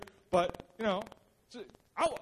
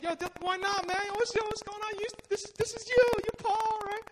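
pushy high school friends; 10 semitones higher than usual. Retold or imitated. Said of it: "They're like".

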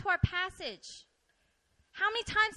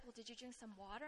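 very soft, quick aside; mild suggestion; 5 semitones lower, 21 dB quieter, faster than usual. Retold or imitated. Coworker answering a dizzy complainer, mild soft suggestion. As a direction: offer it quietly and quickly, as a retold coworker.

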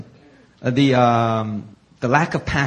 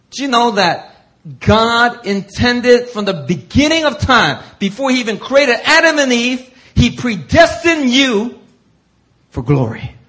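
much higher, louder, slow; full-force preaching; 9 semitones higher, 6 dB louder than usual. Acted. Preacher urging the congregation about glory, loud and high. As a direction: pour it out on the crowd. Impassioned appeal.

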